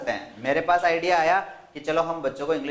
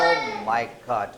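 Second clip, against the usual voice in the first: slow, low, drawn-out oh my God; mock student exclaiming; 7 semitones lower, much slower than usual. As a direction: imitate a student muttering oh my God in class. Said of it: "ये बैठे बैठे कौन बोलते हैं". (धीमे, खिंचे स्वर में oh my God, शरारती छात्र की नकल)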